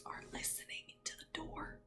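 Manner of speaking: hushed, fast secretive whisper. Acted. Reader telling children the animals listen at the door, whispering. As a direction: whisper as if eavesdropping.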